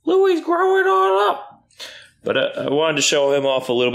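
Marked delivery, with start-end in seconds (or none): 0.0-1.6 high, slow sing-song pet voice. Real, not acted. Owner cooing over her puppy Louis, high and slow. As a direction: coo it out slowly to the puppy.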